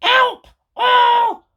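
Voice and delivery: very high, loud panicked cries; panicked screams. Acted. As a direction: scream for help in terror. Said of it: "she screamed".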